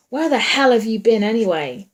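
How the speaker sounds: loud, angry demand; tense and angry. A narrator's reading. Man confronting his partner after a scuffle, angry. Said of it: "Wyatt's voice tense and angry".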